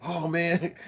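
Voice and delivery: ordinary talk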